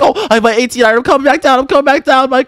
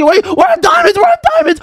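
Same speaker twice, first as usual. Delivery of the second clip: frantic shout; 11 semitones higher than usual. Acted. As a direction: shout in a desperate scramble. Panicking, searching for diamonds.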